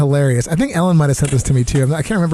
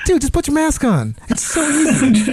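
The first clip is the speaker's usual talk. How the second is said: high, laughing plea; pleading with the maskless guy through laughter; laughing exasperation; 9 semitones higher than usual. Retold or imitated. His exasperated advice, laughing.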